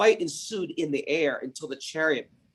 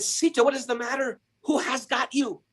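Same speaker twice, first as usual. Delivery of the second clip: put-on old vulture calling; 10 semitones higher than usual. Old vulture calls to Sita.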